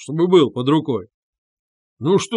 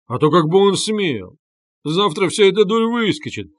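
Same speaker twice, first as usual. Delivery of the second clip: animated, higher-pitched blustering; tipsy shout; 6 semitones higher, 4 dB louder than usual. A narrator's reading. Drunk friend reassuring the women, shouting. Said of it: "закричал хмельной Разумихин".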